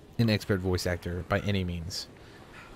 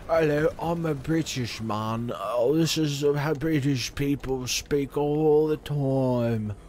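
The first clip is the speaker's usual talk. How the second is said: bad British accent; 6 semitones higher, much slower than usual. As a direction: drag out a cringey fake British accent. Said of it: "being bad at an accent".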